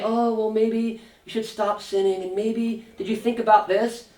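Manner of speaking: a hedging friend too scared to confront; timid soft friend. Retold or imitated. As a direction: tiptoe around it like an overly timid friend. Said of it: "A good friend isn't just gonna say".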